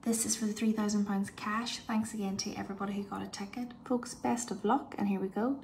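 ordinary talk

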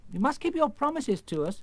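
high insistent exclamation; exclaiming. Acted. Girl holding the wizard to his promises, exclaiming. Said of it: "exclaimed Dorothy".

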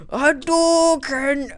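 long, high battle cry; slow, high-pitched yell; drawn-out attack call. Pretend. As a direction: stretch it out like a huge special move. Calls out a game attack.

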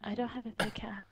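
unvoiced whispered complaint. Real, not acted. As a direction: whisper it under your breath.

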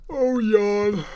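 high loud fake yawn; mock yawn. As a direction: stretch it out like a yawn. Mock yawning aloud.

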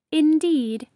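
ordinary delivery

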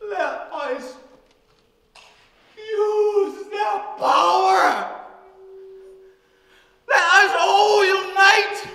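slow, shouted rallying cry; ranting dictator speech. Acted. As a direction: thunder each phrase out.

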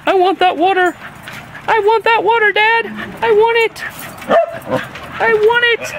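put-on high, pleading little-kid voice for the dog; whining dog voice. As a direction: beg for the water like a whiny puppy. Voicing the whining dog again.

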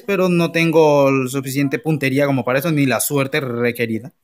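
ordinary delivery

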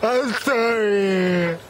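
high, drawn-out mock wail; mock apology. Joking about catching a fish hook to the face, high drawn-out mock apology. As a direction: stretch it out high in pretend distress.

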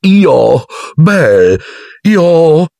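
high, halting, caught off guard; flustered spluttering. A narrator's reading. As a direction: splutter in high-pitched bewilderment. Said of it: "farfugliò Lockhart". (farfugliando, acuto e confuso)

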